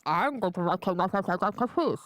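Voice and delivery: mumbled, garbled godfather impression; hammed-up mafia boss, words swallowed; badly faked film-don voice; Marlon Brando. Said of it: "Do you remember when he was like".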